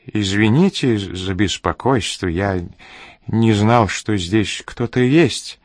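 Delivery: usual speaking voice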